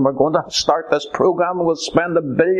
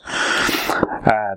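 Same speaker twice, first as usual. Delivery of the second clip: ordinary talk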